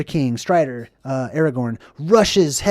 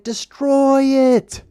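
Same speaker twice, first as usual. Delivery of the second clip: much higher, slow stretched plea; urging cry; 9 semitones higher, 3 dB louder, much slower than usual. Retold or imitated. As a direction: drag it out high, desperate. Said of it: "he's like".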